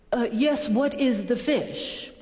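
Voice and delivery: mock-superior, stretched-out delivery; acting snobby. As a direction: ask it like a condescending snob, very slowly. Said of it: "I was being a jerk".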